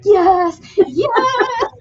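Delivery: high, squealing delighted yes; gleeful yes. Retold or imitated. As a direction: squeal with joy at the praise. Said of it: "I'm like".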